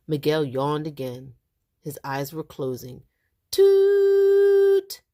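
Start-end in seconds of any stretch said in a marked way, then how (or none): 3.5-5.0 long, very high, loud honk; truck horn toot. Acted. Father's truck horn imitated.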